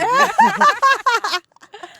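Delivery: big high burst of laughter; loud high laugh. Real, not acted. Laughs at hurtful comment.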